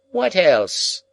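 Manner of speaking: put-on high, loud old woman's prompt; abrupt Miss Havisham. A narrator's reading. Miss Havisham abruptly prompts Pip, high and loud. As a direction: snap the question out high.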